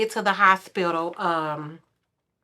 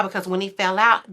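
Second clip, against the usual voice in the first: ordinary talk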